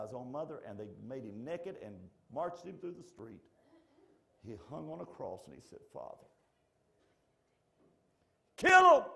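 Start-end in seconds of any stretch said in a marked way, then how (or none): 5.9-9.0 vengeful cry. Acted. Wrong version of words from the cross, sudden furious shout. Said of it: "That ain't what he said".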